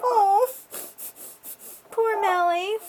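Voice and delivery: playful mock sympathy for the heartbroken girl; mock pity. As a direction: coo it with exaggerated fake sympathy. Mocking the character's sad fate.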